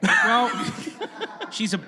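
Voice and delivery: ordinary talk, nothing marked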